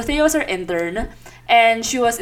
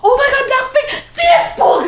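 much higher, louder squeal ending in a bonk; excited shriek then crash; 12 semitones higher, 4 dB louder than usual. Retold or imitated. Retelling crashing into the door, excited shriek. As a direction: squeal and smack into glass.

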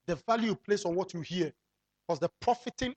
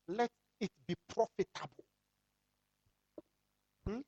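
ordinary talk, nothing marked

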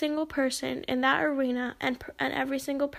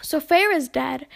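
usual speaking voice

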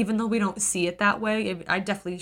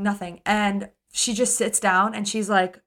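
normal talking voice

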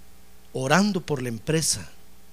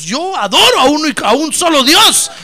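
high, loud declaration of faith; loud preacher-style witness; fervent proclamation; 9 semitones higher, 13 dB louder than usual. Acted. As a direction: proclaim the one true God boldly. Daniel proclaiming his faith.

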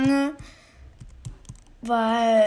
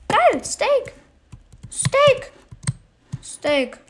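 high, delighted squeal over the find; light up at the steak; excited; 10 semitones higher, much slower than usual. Real, not acted. Hungry player spotting steak, delighted and high. Finding food in the game.

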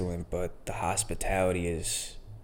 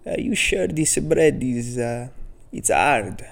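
slow, higher, lightly put-on old Italian voice; imitating old Italian deli man; 4 semitones higher, 4 dB louder, slower than usual. Old deli owner warning a customer about hard bread, lightly imitated. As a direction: stretch out the old man's worried question. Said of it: "he's a funny old Italian guy".

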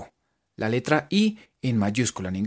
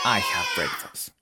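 lower repeat; 8 semitones lower, 3 dB quieter, slower than usual. Shown as a demonstration. Repeat of modelled phrase.

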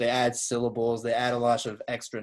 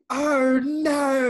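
"Oh, no" much higher and louder, an R added; hammed-up British oh no; 12 semitones higher, 7 dB louder than usual. Host hamming up the British "Oh, no", high and loud. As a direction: squeal "Oh, no" with an R. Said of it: "they say".

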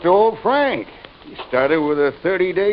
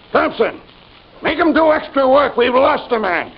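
harsh angry orders; 7 semitones higher than usual. Acted. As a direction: snap out cruel orders. Guard ordering harsh punishment.

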